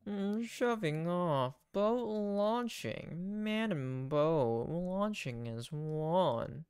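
very slow, drawn-out words; grandly drawling boat announcement; hammed-up launch call. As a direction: stretch it out like a ceremonial captain. [name] playfully announces boat launching.

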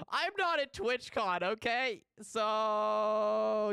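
high, drawn-out whiny outburst; mock upset. Streamer admitting she's missing TwitchCon, mock upset wail. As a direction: wail it high, stretching the last word.

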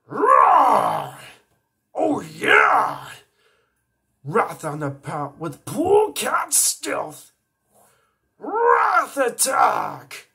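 high, loud, slow beastly shouting; Wrath roar. Acted. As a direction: roar every word like a brute. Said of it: "I sound just like them".

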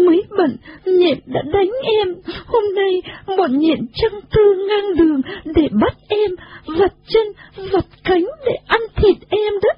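high, plaintive sobbing little voice; tearful pleading account. A narrator's reading. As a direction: pour out your woes, whimpering up high. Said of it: "Nhà trò đứng khóc". (giọng cao, mếu máo kể khổ)

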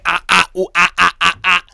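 loud high whooping; rowdy celebratory hollers; hype cheering. Retold or imitated. Celebrating return to the island.

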